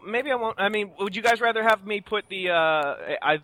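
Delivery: ordinary delivery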